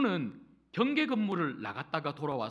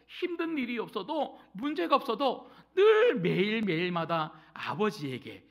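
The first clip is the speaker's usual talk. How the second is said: preach; 5 dB louder than usual. Real, not acted. Preaching at full force.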